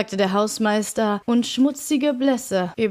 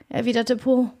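plain reading voice

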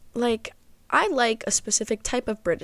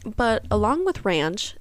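ordinary talk, nothing marked

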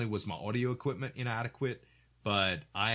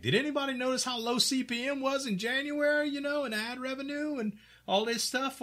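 much higher, airy put-on voice; fellow streamer voice. Streamer asking other creators about ad revenue, high mock voice. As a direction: mimic a fretting fellow streamer.

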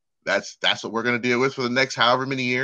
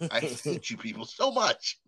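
mock hateful drawl; 9 dB quieter, slower than usual. Mock racist voice.

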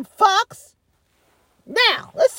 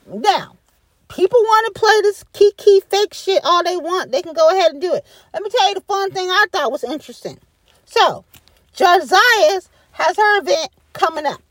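ordinary talk, nothing marked

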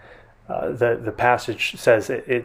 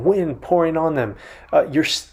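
ordinary talk, nothing marked

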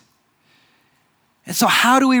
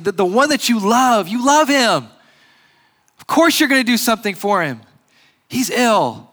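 a hint of anxious sisters; mildly pleading sisters; 4 semitones higher than usual. Voicing sisters' reasoning.

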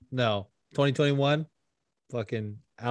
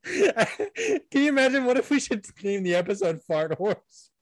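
laughing; 10 semitones higher than usual. Real, not acted. Laughing at fart joke.

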